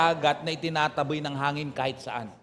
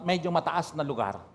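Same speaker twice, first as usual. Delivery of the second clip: ordinary talk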